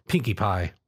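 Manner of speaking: plain reading voice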